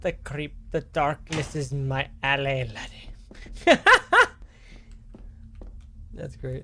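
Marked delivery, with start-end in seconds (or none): 0.0-3.8 mock Scottish old man. Streamer mocking the creepy gardener, hammed-up Scottish accent. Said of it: "Get this old guy off". 3.8-4.5 burst of laughter. Real, not acted. Laughs at own impression.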